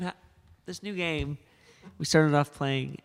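usual speaking voice

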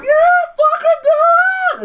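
very high loud squealing shout; mock female moaning. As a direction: shriek it like a hammed-up woman. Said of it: "these women like".

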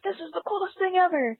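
mock gushing child, pitched well up; excited kid. Co-host mimicking kids thrilled by the game, high mock excitement. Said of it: "group of prepubescent children who are like".

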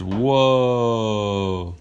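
a long, drawn-out "whoa" of wonder; awed whoa. Acted. Storyteller voicing pirates amazed at a whale, drawn-out awe. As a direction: stretch it out in amazement.

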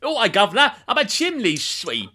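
hammed-up bad cockney accent from Mary Poppins. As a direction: take the piss out of a terrible cockney accent.

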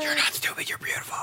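whisper. Acted. Whispered encouragement to Dum Dum.

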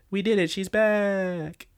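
high, drawn-out silly celebration; goofy cheer. Retold or imitated. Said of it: "how goofy she says it".

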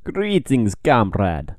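a bad Russian accent greeting a comrade; hammy Russian accent; terrible Russian accent. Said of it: "that's a terrible Russian accent".

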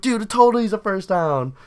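high, insistent retold protest; indignant insistence. Arguing the first down call, mock-indignant.

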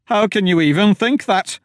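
high, loud shout; terrified outraged yell. A narrator's reading. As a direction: yell in disbelief. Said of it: "Wilmer shouted".

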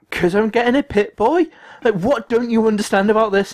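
high-pitched, indignant excitement; retold excitement. Telling a workmate about his Pip-Boy, excited and indignant. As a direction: act out being thrilled and amazed they don't get it. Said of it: "Why are you so excited?".

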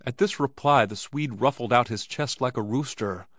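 plain reading voice

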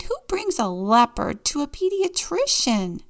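mildly shouted, high-pitched protest; complaining shout. A narrator's reading. Waiting-room parents complain about a leopard, a mild indignant shout. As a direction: raise the pitch in outraged complaint. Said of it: "they shouted".

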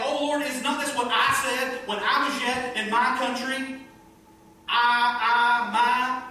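loud, high, whiny complaint; giving God the business. Acted. Jonah griping at God, loud and whiny. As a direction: nag and needle God. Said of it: "gives God the business".

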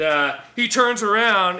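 ordinary delivery

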